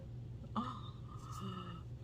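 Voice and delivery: sharp intake of breath; shocked gasp. Real, not acted. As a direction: gasp at the damage. Gasp at finding soft stem.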